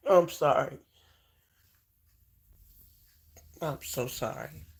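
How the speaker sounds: apologizing while crying; sorry through real tears; tearful apology. Real, not acted. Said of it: "I did not mean to cry on here".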